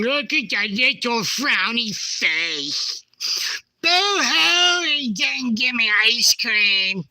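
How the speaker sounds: high whiny baby voice, hammed-up; mock crybaby. Mocking the sulky face in his photo, high baby whine. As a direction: pout it like a sulky toddler.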